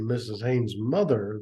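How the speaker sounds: plain reading voice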